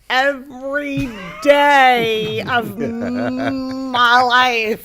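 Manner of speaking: comic shout. Real, not acted. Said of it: "I wanna kill Steve".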